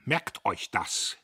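emphatic order; stern warning. A narrator's reading. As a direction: press the warning on the workers, drawn out. Boss warns the workers.

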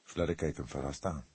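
plain reading voice

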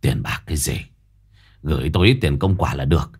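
brushing off money then naming a price, low; old Quý waving it off. A narrator's reading. Old Quý to the mother, low and sly. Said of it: "rồi lại xua tay".

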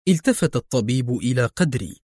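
ordinary delivery

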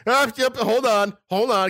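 put-on high drunken brush-off; drunk stalling. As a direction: hold them off, tipsy. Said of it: "I'm like".

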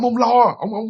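normal talking voice

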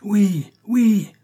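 high, loud shouts of assent; crowd's cry. A narrator's reading. Sakei followers approve their speaker, crying out. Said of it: "s'écriaient les Sakei".

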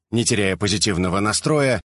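usual speaking voice

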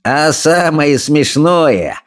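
high, drawn-out mocking voice; jeering bully. A narrator's reading. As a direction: taunt the others with relish. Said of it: "не унимался Сопляк".

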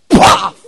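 loud, sudden "paf" of a slap; imitated slap sound. Preacher retelling the slap he got, imitated smack. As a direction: burst out a sharp smack noise. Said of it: "escuché un".

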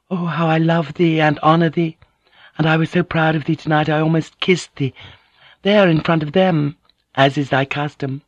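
high-pitched, ardent woman's character voice; Mariko, adoring. A narrator's reading. Mariko tells Blackthorne how proud she is, adoring. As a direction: pour out love and pride.

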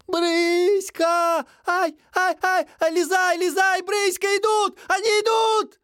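put-on shrill panicked girl's scream, very high; frantic shouting. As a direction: scream the warning in terror. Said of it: "надрывно кричала вещанка Ишка".

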